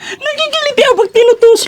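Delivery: high giggly squeal; being tickled. Real, not acted. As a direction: laugh it out while poked. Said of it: "ang ganda mo naman tumawa".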